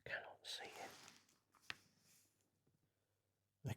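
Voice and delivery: whispered mutter to oneself; whispering. Real, not acted. Builder to himself, unable to see the piece, whispering. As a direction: say it under your breath, barely voiced. Struggling to see, talking to self.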